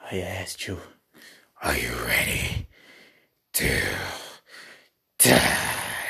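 a low, dark whisper from the casket; ominous whisper. Retold or imitated. Risen wrestler threatens his opponent in an ominous whisper. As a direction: breathe the threat like a zombie rising from a coffin. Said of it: "ominous voice".

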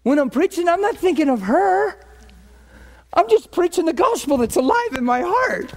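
exasperated defence, pitched far up; high-pitched protest. Retold or imitated. Pastor answering wife about his preaching, high exasperated protest. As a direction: plead your innocence in a high, strained-up voice. Acted protest to wife's claim.

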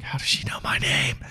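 hushed secret whisper. Retold or imitated. Asking Eric quietly about the lady, hushed whisper. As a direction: whisper it so she won't hear.

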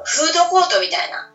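ordinary talk

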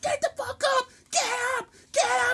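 very high, loud screamed orders; piercing acted wake-up yell; mock drill-instructor shout. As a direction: roust the barracks, imitating the instructor. Instructor yelling everyone out of bed.

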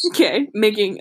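normal talking voice

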